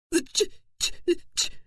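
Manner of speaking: stammering through chattering teeth; shaking too hard to speak. Acted. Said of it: "Her teeth were chattering uncontrollably".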